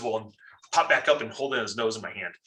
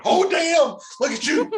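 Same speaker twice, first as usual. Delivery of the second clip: much higher and louder, laughing; mock amazement; 6 dB louder, slower than usual. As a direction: gush at him, cracking up.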